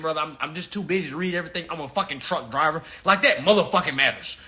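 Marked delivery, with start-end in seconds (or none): 0.0-2.8 imitating a client. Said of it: "sitting your ass down and saying".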